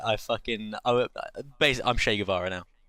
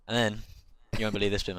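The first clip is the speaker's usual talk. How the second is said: usual speaking voice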